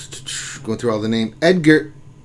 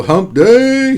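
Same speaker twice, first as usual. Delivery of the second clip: hump day cheer; 9 semitones higher, 6 dB louder than usual. Acted. Reading chat, echoing catchphrase.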